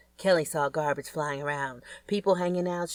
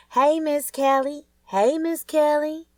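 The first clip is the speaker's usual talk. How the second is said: put-on higher, louder, slow kid calling out; kids yelling; 11 semitones higher, 5 dB louder, slower than usual. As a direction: call across the street like an excited child. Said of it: "The kids were yelling and waving".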